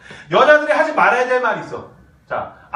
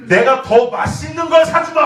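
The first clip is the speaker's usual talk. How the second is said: loud chest-puffing promise; proud man; 6 semitones higher, 7 dB louder than usual. Retold or imitated. 칭찬받은 남자를 흉내: 우쭐하게 큰소리. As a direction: boast like a flattered boyfriend. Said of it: "남자가".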